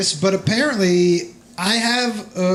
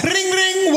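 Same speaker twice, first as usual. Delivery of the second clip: mock bike bell; 10 semitones higher than usual. Mocking a bicycle bell.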